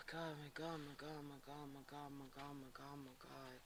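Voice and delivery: a real prayer-like breath that dies away; overwhelmed murmur fading out. Real, not acted. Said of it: "I feel really heavy".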